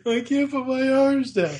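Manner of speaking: put-on whiny child. A lightly voiced whining kid while laughing. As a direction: whimper it like the little brother. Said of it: "Poor Randy".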